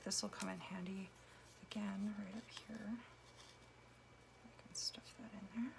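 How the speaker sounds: soft whispered aside; whispering. Real, not acted. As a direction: whisper to yourself while crafting. Whispered aside while cutting.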